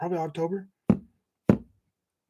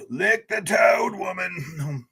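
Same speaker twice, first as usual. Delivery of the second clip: playful slow shout; much slower than usual. Acted. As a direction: roar it out like a silly battle cry. Shouts chat's phrase playfully.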